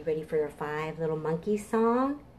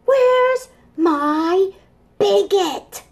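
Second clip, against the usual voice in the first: put-on high, pleading child voice; small dinosaur wanting his blanket; whiny little dinosaur; 8 dB louder, much slower than usual. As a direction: whine like a tired little kid. Dinosaur child whining in story.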